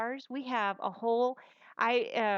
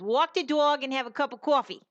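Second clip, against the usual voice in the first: louder put-on New York accent, higher pitch; 4 semitones higher, 6 dB louder than usual. Shown as a demonstration. Mom doing a New York accent for the kids. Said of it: "my New York or my Boston accent".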